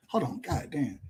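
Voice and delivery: ordinary talk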